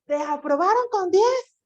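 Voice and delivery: teacher demoing surprise in a question; amazed question. Shown as a demonstration. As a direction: ask as if you can't believe the top grade. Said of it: "Con una sorpresa así".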